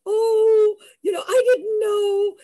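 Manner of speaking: high, loud, crying apology; tearful apology. Retold or imitated. Girlfriend apologizing to furious boyfriend, tearful. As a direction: plead sorry through tears. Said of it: "I felt responsible".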